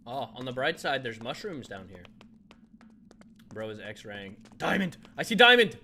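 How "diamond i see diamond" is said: loud, high excited yell; excited shout. Real, not acted. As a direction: shout it out in delight. Spots diamond in game.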